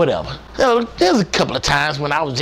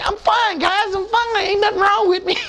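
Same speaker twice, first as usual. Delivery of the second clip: high carefree brush-off; high breezy I'm fine; cocky sailor. Retold or imitated. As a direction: wave off the worry.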